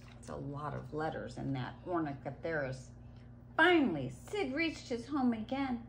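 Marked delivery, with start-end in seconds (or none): none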